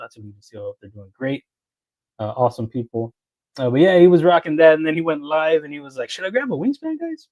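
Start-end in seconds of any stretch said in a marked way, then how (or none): 6.1-7.3 imitating a viewer, pitched much higher; high mock question. Streamer imitating Caleb asking his chat, in a high teasing voice.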